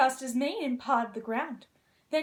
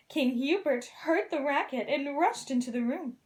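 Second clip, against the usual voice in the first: usual speaking voice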